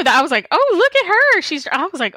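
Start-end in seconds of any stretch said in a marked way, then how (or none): 0.5-1.4 high, teasing "ooh, fancy" reaction; sing-song mock admiration, pitched way up; mock impressed. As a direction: act out being playfully impressed. Acting own impressed reaction.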